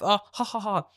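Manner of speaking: high, put-on ha-ha laugh; acted-out chuckling; imitated group laughter. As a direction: mimic the whole class laughing. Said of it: "everyone's laughing".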